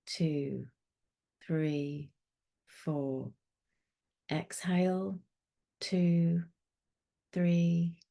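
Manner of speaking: soft, slow counting through each breath; calm breathing coach, counting gently; guided meditation. Acted.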